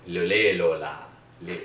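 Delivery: loud mock French babble. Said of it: "they think you're French when you're doing it".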